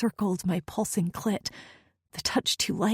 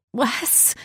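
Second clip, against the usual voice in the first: a higher, pleading little plea of his name; 6 semitones higher than usual. Acted. Woman pleading with her stubborn lover in the shower. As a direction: plead with him to give in. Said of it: "I pled".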